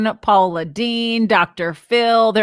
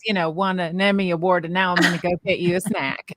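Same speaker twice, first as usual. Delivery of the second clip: mock-sweet mom voice, lower and softer, picking up speed; sarcastic mom; 6 semitones lower, 4 dB quieter, much faster than usual. Mom answering her kids after winning an Emmy, mock-sweet and wry. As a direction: play the doting mom with a dry, self-mocking edge. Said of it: "You're like".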